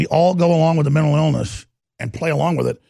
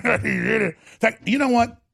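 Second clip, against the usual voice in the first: usual speaking voice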